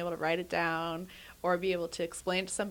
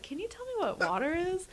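mock puzzled question; 11 semitones higher than usual. Imitating a puzzled customer.

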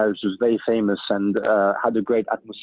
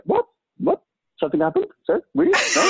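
rising, incredulous questions that climb ever higher; mock disbelief. Retelling his reply to the conductor, feigned disbelief. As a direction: act baffled, as if nothing went wrong. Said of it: "And I said".

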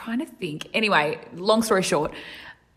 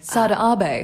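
imitating Japanese accent; 2 semitones higher, 4 dB louder than usual. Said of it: "your Japanese accent".

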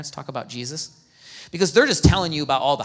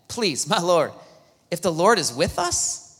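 ordinary delivery

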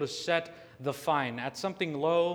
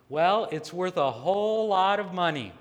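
sarcastic; 4 semitones higher, 6 dB louder than usual. Retold or imitated. Defendant answering the judge about her assets, sarcastic. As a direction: imitate a smirking, sarcastic defendant. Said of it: "in a sarcastic tone says".

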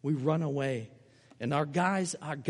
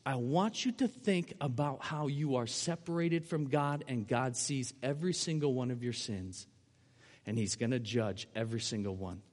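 solemn warning; 5 semitones lower, 6 dB quieter than usual. Retold or imitated. [name] quotes his solemn warning.

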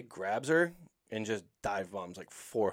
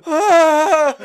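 much higher, much louder imitated shriek, laughing; terrified scream; 19 dB louder than usual. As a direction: shriek like a scared hiker facing a lion. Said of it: "he's clearly so terrified".

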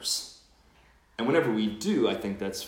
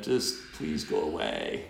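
grumpy friend in bed; 6 semitones lower than usual. Retold or imitated.

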